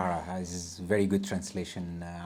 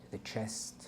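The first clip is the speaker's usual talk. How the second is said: calm, soft meditation guide voice; guided meditation; 10 semitones higher, 6 dB quieter than usual. Acted. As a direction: ease them into a body scan. Leads a grounding meditation.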